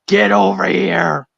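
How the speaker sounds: slow, drawn-out pretend threat; mock menacing pursuer. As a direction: stretch it out like a playful chaser. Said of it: "and I'm like".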